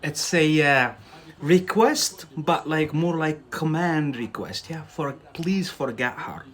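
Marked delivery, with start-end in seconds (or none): none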